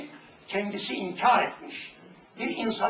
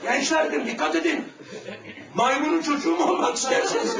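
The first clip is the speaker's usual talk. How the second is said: ordinary talk, nothing marked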